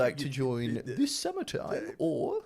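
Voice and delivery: slow, drawn-out polite offer; Dan impression. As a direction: mimic Dan's careful phone ask. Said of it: "That's actually really accurate".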